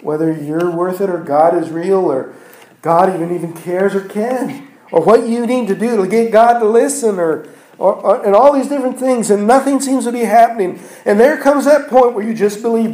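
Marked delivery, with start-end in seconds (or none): 4.9-12.9 higher and louder, pressing the congregation; rising preacher's fervour; preaching climax. Real, not acted. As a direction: build it up and push each phrase out.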